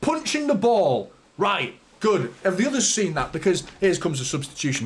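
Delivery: ordinary talk, nothing marked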